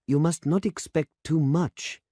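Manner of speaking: put-on anxious young demonstrator pleading against high hopes; anxious young man. A narrator's reading. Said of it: "said the young man anxiously".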